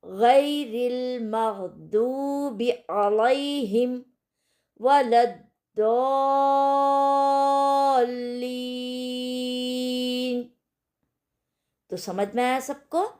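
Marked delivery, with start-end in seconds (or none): 0.0-10.5 slow chanted Quran recitation, last word held very long; full verse recitation. Shown as a demonstration. Teacher recites the full verse for students, slow chant. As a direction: recite the whole verse slowly, holding वलद्दौलीन. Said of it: "पूरी आयत की हम यहाँ पर practice करेंगे".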